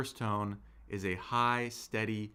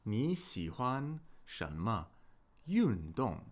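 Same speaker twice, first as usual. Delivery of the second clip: slow, with a pause before 运动; slow model reading; slower than usual. Shown as a demonstration. Teacher models the topic for learners, slow and segmented. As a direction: sound out 你喜欢什么, pause, then 运动.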